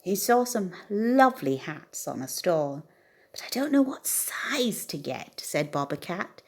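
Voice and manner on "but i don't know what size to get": whispering to himself. A narrator's reading. Bobcat frets alone over hat sizes, in a whisper. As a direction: whisper the worry under your breath.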